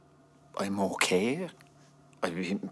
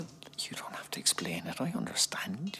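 soft reassurance; 5 semitones higher, 4 dB quieter than usual. Acted. Husband reassuring wife softly.

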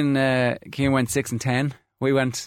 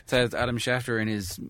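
ordinary talk

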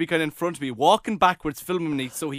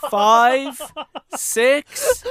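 imitating nervous count; 9 semitones higher, 6 dB louder than usual. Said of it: "Nathan goes".

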